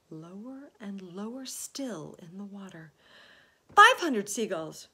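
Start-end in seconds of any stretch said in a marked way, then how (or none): 3.7-4.9 very loud announced tally; big triumphant call; shouting. A narrator's reading. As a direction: shout the count for all to hear. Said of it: "shouted James".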